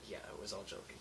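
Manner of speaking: ordinary talk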